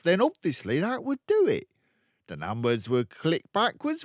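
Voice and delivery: loud, high, gloating crook; walk him through the scheme proudly; boastful dealer. Acted. Father explaining his scam to his son, loud gloat. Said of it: "father continues boasting".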